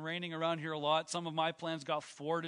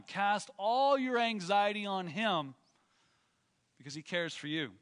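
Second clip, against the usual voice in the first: scripture reading; 4 semitones higher than usual. Acted. Reads scripture in preaching voice.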